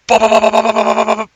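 high, loud bop bop bop; imitating rapid pings. Host mimicking the Schumann readout to her co-host, high and punchy. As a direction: mimic a meter pinging fast. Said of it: "It's pinging in rapid succession".

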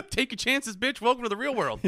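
high, loud hammed-up tough-love voice; mock tough parent. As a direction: sneer it like a jaded grown-up welcoming a kid to life.

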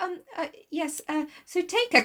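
high, hesitant, flustered mother; acted choked-up farewell; mock emotional goodbye. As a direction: stumble tearfully over the words. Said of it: "don't be so emotional".

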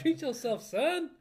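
high laughing toast. Real, not acted. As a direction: cheer it out through laughter.

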